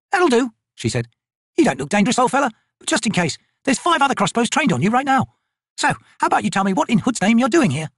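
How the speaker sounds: high-pitched, no-nonsense soldier voice; tough corporal. Acted. Corporal interrogates a harmless-looking old man, tough and brisk. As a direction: question a stranger at crossbow point.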